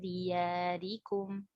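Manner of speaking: very slow chant with held nasals and vowels, softening towards the end; Quran recitation. Shown as a demonstration. Teacher reciting the whole verse for students, slow recitation. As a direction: recite with long, measured elongations.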